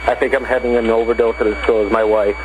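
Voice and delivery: drugged. Real, not acted. High caller on 911 recording.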